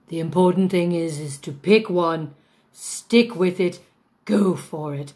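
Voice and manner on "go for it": ordinary talk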